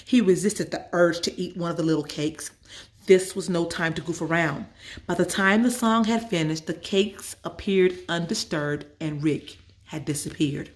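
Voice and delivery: ordinary delivery, nothing marked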